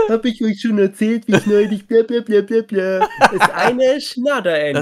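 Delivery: high, loud parody voice; hammy gossip voice; mock chatterbox. Parodying Andrea's endless anecdotes.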